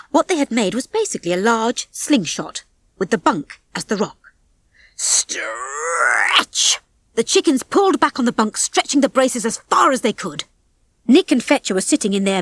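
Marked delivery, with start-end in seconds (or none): 5.0-6.8 low, creaking, drawn-out word; straining stretch sound. A narrator's reading. Narrator voices the braces stretching, straining. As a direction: draw the word out like straining elastic.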